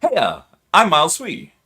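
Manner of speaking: hyped channel intro. Shown as a demonstration. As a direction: sell your name like a YouTube intro. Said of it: "take a couple deep breaths and go".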